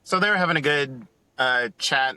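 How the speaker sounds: ordinary talk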